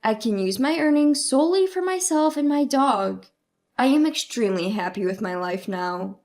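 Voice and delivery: plain reading voice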